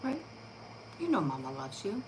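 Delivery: soft, tender reassurance. Real, not acted. Owner softly reassures her nervous dog, tender. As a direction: soothe a scared puppy.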